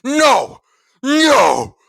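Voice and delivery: high, full-force shouted refusal; explosive raging scream; furious yell. Acted. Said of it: "I let out a furious yell".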